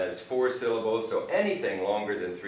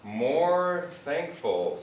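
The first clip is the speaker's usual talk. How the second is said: slow, stressed MORE in "more thankful"; slow model phrase; much slower than usual. Shown as a demonstration. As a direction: stretch "more thankful" out slowly for students. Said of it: "So what I do when".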